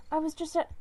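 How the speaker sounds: eager burst. Acted. She-cat eagerly greeting him before it all stops. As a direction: start brightly then break off.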